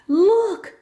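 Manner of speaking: high excited call of look; a child calling out at a discovery; a bright, high shout; excited yell. A narrator's reading. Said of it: "she yells".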